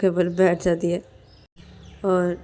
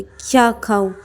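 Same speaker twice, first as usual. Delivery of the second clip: slightly raised, pushy comeback; mild retort; 4 semitones higher, 5 dB louder than usual. Acted. Payal tells Ananya she is hungry too, mildly indignant. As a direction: turn it back on her, lightly indignant.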